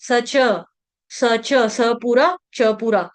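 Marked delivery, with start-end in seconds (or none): none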